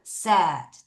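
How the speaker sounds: said as one short syllable, "sed"; pronunciation of said. Shown as a demonstration. Teacher modelling the irregular past form, said pronounced "sed". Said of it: "발음".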